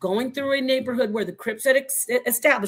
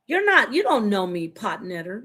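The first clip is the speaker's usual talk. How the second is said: usual speaking voice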